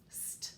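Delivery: voiceless, soft "St, st" hissed out; very soft unvoiced "st" sound; whispered blend. Shown as a demonstration. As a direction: isolate the "st" blend in a breathy hiss. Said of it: "What two letters do you hear".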